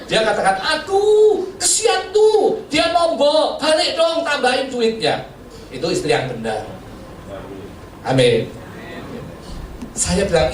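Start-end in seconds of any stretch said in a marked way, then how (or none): 0.6-5.3 high, pleading wife voice; mock sweet wife, slowed at the end; imitating wife. Wife voiced in preacher's story.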